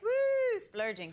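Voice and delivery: high, loud mock cheer; excited woo. Guest retelling the wine bill, cheering the splurge. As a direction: celebrate the splurge, high and bright. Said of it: "so we were like".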